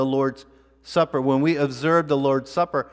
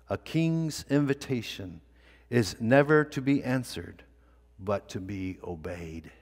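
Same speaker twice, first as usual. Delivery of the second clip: solemn quiet pronouncement; 4 semitones lower, 7 dB quieter than usual. Acted. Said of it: "one of the choicest sentences of his life".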